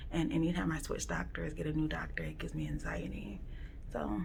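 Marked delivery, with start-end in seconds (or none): 2.2-4.2 whispering. Real, not acted. Quiet waiting room, nervous.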